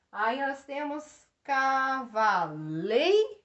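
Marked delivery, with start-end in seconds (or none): 1.4-3.4 "cavaleiro" slowly, syllable by syllable; "cavaleiro" as ca, va, a long LEI, ro; stress on penultimate syllable. Shown as a demonstration. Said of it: "Penúltima sílaba mais forte".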